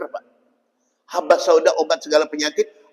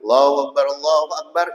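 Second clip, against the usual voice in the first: choppy rushed call. Retold or imitated. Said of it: "Akhirnya yang azan ganti".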